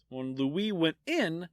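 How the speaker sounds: ordinary delivery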